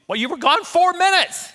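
husband mimicking his wife's comeback; imitating wife. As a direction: mimic Pam's retort in a high voice. Wife retorts, husband imitates her.

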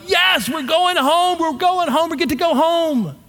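high, slow, gleeful celebration; singing out the joy of going home; overjoyed cheer. Retold or imitated. Pastor reliving high-fiving his wife over the job offer, gleefully high.

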